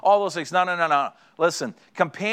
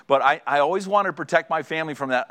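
normal talking voice

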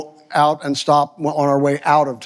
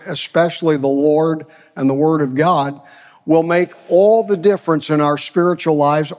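ordinary talk, nothing marked

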